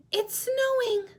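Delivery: very high squeaky penguin voice; a delighted little chick. Shown as a demonstration. Teacher voicing Little Penguin, high and squeaky. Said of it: "show you how to use voices".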